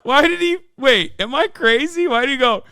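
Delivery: high, disbelieving mock squeal; squeaky scoffing at the clip; incredulous mockery. Real, not acted. Mocking the clip, pitched way up.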